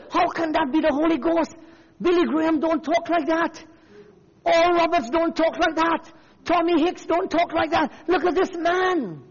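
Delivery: high, insistent mock indignation; indignant critics. Preacher voicing people and ministers criticizing Branham, mock indignant outcry. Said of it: "He's feeling it from the people".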